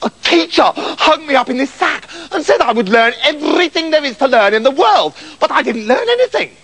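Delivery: high, aggrieved complaint; hammed-up indignant wail; crying out. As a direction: pour out your grievance to a rescuer. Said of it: "cried Septimus".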